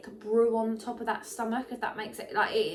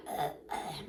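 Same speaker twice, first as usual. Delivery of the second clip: low, soft, voiceless throat-clearing grunts; mock throat clearing; 7 semitones lower, 6 dB quieter than usual. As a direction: clear the throat twice, rasping. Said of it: "you're like".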